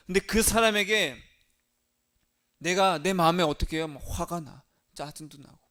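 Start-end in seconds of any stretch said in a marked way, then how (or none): none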